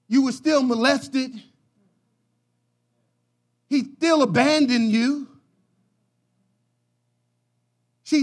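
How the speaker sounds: loud, punched preaching lines; loud preacher emphasis; preaching shout. Acted. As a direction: hammer each line out to the congregation. Preacher driving point home.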